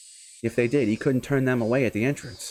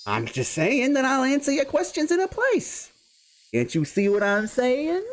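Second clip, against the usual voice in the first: a hint of a cornered thug, voice climbing higher; defensive guardian; 6 semitones higher than usual. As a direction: bluster back at the official. Guardian snaps at caseworker.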